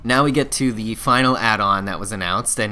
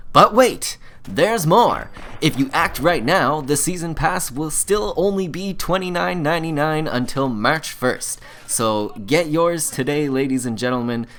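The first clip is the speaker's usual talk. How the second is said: home shopping host; 2 semitones higher than usual. Acted. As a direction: sell it like a late-night infomercial host. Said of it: "my little excited shopping channel host impression".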